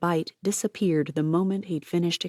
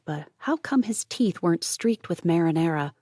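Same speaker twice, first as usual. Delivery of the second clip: usual speaking voice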